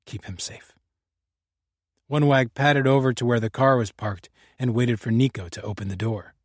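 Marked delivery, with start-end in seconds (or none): 0.1-0.8 hushed breathy whisper to the dog; whispered aside. Acted.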